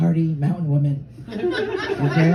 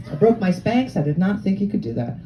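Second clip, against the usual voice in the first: normal talking voice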